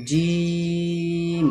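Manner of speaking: জিম held very long, tongue pressed; long pressed jim. Shown as a demonstration. Teacher modelling the long pressed jim for pupils. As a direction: stretch জিম out for three seconds. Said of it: "তো কি বলবে?".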